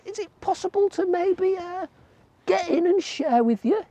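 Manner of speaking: a chilly frog begging for shelter; high cold frog voice. A narrator's reading. Frog asks the mouse for a warm home: high pleading voice. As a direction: pitch it high and plead, shivering.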